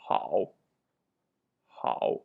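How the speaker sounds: "Hao" dipped low in third tone. Shown as a demonstration. Teacher modeling Chinese for learners, low dipping tone. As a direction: model "Hao" with a deep dip. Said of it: "Third tone".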